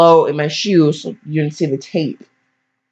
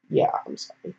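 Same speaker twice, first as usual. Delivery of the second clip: whisper; 9 semitones lower, 11 dB quieter than usual. Real, not acted. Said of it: "whispered apology".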